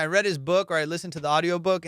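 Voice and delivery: usual speaking voice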